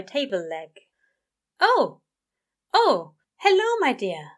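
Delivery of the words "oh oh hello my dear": louder, higher startled yelps of greeting; startled stammer. A narrator's reading. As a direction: caught off guard, flustered hello. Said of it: "he stammered".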